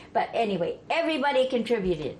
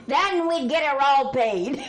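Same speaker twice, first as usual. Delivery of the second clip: laughing; 9 semitones higher than usual. Real, not acted. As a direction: push the line out through laughter. Laughing after the punchline.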